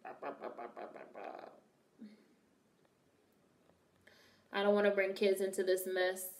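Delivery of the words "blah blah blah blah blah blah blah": dismissive blah blah. Retold or imitated. Mocking the friend's chatter.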